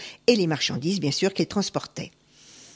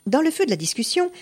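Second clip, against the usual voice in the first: plain reading voice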